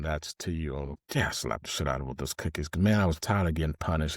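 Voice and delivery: normal talking voice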